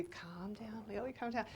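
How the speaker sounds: hushed inner pep talk; self-soothing. Retold or imitated. Fan talking herself down before Oprah, hushed self-soothing. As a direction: murmur it softly to yourself. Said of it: "And I'm trying to be".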